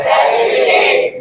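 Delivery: slow, breathy whisper of the word; whispered answer. Shown as a demonstration. Teacher whispers the answer to the class, slow and hushed. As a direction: whisper it slowly, drawing it out.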